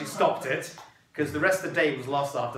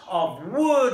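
ordinary talk, nothing marked

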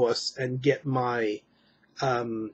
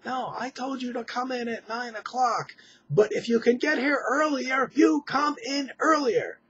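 higher, mock yelled with a put-on accent; hammed-up angry supervisor, pitched up; imitating screaming boss; 10 semitones higher than usual. Mocking the boss who screams down the phone, high mock yell. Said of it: "she screams at me".

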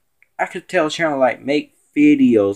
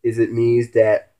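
ordinary talk

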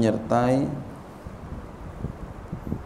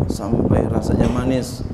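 ordinary delivery

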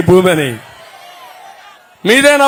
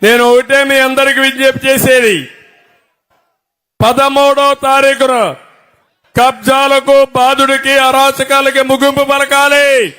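rally speech; 4 semitones higher than usual. Real, not acted. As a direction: rouse the crowd with every line. Rally speech calling the crowd. (Rally ప్రసంగం, గట్టిగా)

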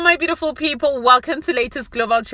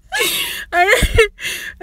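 high-pitched cackling laugh. Real, not acted. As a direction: burst out cackling. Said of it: "I can't stop laughing".

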